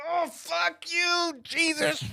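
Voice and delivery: slow, very high strained cursing; agonized cursing. Retold or imitated. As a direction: draw the curse out high, in mock agony. Cursing through the worst cramp.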